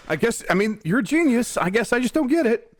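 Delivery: high-pitched, helplessly puzzled actor; Norman Reedus, bewildered. Acted. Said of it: "can you imagine Norman Reedus' surprise".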